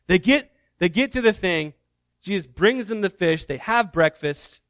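usual speaking voice

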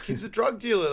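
high, alarmed cry; family panicking about intruder, high alarmed cry; panicked accusation. Retold or imitated. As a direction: shriek it in mock panic. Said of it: "they're like".

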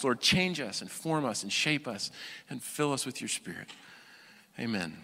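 soft, earnest prayer voice; preacher leads a soft prayer before teaching. Real, not acted. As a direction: pray quietly for the listeners.

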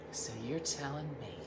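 a hint of offended disbelief; indignant. [name] to Dean on the phone, mock indignant. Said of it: "real indignant".

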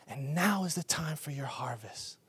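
hushed, whispered promise; prophetic whisper. Retold or imitated. Hospital woman whispering a prophecy of harvest to the pastor. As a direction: breathe it out like a secret blessing.

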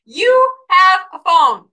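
slow, loud, high-pitched insistence; emphatic exhortation. Real, not acted. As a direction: spell it out word by word, loudly. Repeats exhortation slowly, emphatically.

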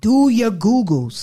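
slow, loud sassy drawl; mocking retort. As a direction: drag out the put-down. Repeats mocking line.

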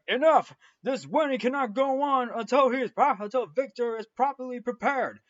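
high, loud outburst of mock authority; angry pastor. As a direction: burst out on the first word, then keep scolding high. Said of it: "the pastor is like".

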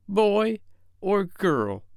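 put-on high, very slow child's question; small boy, hesitant and pitched high; not too hopeful. A narrator's reading. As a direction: ask it as a doubtful little boy. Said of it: "not too hopefully".

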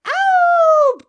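long, very high and very loud howl; full howl. Retold or imitated. Drunk neighbor's big howl at the moon. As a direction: throw the head back and howl at the moon. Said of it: "He would go".

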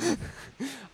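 soft, high laugh; real laugh. Real, not acted. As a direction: keep laughing at your own joke. Still laughing at own joke.

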